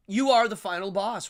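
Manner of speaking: ordinary talk, nothing marked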